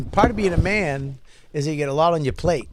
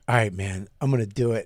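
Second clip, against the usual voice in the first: determined friend; 8 semitones lower than usual. Retold or imitated. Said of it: "he was like".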